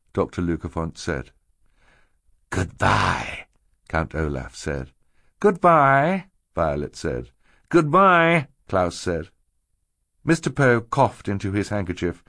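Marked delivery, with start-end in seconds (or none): 2.5-3.5 a hint of Count Olaf's villain voice; lightly voiced villain saying goodbye; villain's farewell. A narrator's reading. 5.4-6.3 put-on high, louder child's voice; young girl's farewell. A narrator's reading. 7.7-8.5 put-on high, louder child's voice; young boy's farewell. A narrator's reading.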